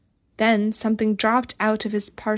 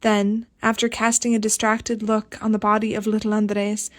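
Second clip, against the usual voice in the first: ordinary delivery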